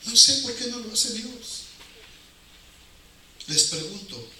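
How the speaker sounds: usual speaking voice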